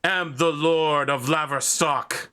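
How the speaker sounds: stomp forward and claim your title; furious lord. Acted. Knight stomping toward the players, furious. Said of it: "he says".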